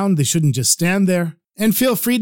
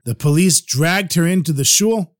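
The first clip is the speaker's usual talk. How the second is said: plain reading voice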